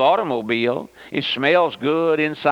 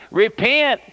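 high, raised prophet's cry; 9 semitones higher, 4 dB louder than usual. Acted. Noah warning the people, crying out. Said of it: "Noah cried".

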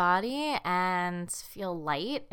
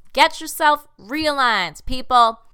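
playful loud call; 6 semitones higher, 12 dB louder, slower than usual. Real, not acted.

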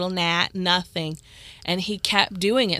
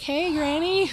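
higher, sing-song hello to Granny; greeting the ghost Granny; 9 semitones higher, slower than usual. Retold or imitated. Greeting cigarette-smoking ghost, higher sing-song hello. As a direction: cheerfully greet an unseen grandma. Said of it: "I'm like".

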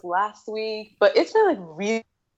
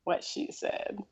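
whisper; 11 dB quieter, slower than usual. Acted. Echoing cohost, whispered.

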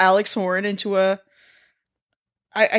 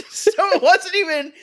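laughing; 10 semitones higher than usual. Real, not acted. Laughing mid-sentence.

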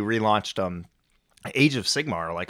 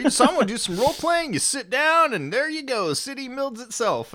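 much higher, excited gamer voice; high enthusiastic friend voice; 4 dB louder than usual. Retold or imitated. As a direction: gush like an eager convert. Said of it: "Brendan was saying".

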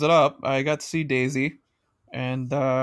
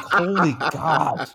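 stunned disbelief; much slower than usual. Retold or imitated. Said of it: "I'm like".

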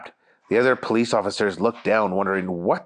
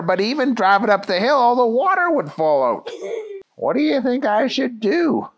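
high, lively cartoon cop voice; Jerry; 9 semitones higher than usual. Jerry reports bad news to the chief, high-voiced. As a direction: pitch lifted, explaining away.

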